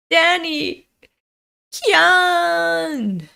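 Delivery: high, drawn-out acted call; anguished cry. Owner crying out for his burning dog, high anguished call. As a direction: call out high, stretching the last word.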